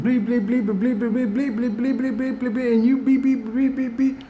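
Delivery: high, rapid bleeping rant; hammed-up cursing tirade, words bleeped out; a high, silly stand-in for a string of swear words; mock cursing rant. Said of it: "And I ain't like I was cursing".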